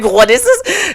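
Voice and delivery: high, loud, through laughter; laughing confused question. Acted. Reading the comic, he laughs through the hero's confused question. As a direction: ask it while cracking up.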